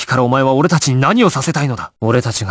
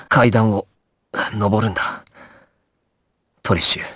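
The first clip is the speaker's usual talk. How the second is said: weakening, fading. Acted. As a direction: struggle as strength drains. Body failing, vision fading. (力尽きかけた弱々しい声)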